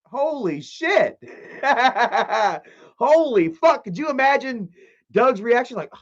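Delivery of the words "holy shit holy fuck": much higher, stunned disbelief; mock shocked dad. Vlogger acting the father's shocked reaction, high and stunned. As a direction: react like a dying man hearing something outrageous. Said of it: "Can you imagine Doug being like".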